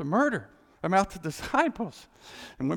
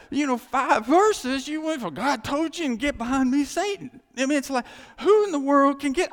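higher and louder, pushing hard; fired-up preaching; 9 semitones higher, 8 dB louder than usual. Acted. Preacher rouses congregation about Peter, loud and high. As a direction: preach it at full force. Preaching builds to full force.

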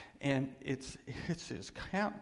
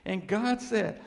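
loud, slow, soaring pulpit build; rising to a booming sermon climax; preaching at full force; 6 semitones higher, 6 dB louder than usual. Real, not acted. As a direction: thunder it out to the congregation.

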